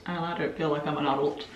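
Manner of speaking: ordinary talk, nothing marked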